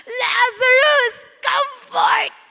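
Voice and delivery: slow, ringing impassioned cry; passionate shout. Retold or imitated. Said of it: "Bakit ganun ka-passionate si Lord?".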